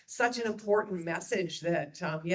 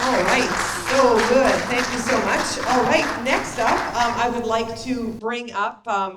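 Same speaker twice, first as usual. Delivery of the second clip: projected, upbeat host voice; loud emcee voice; 3 semitones higher, 10 dB louder than usual. Real, not acted. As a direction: carry it over the room. Host addressing crowd after applause.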